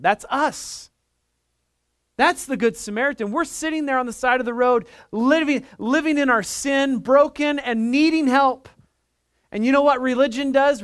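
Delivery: high, forceful sermon delivery; impassioned preaching. Real, not acted. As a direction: preach it with rising urgency.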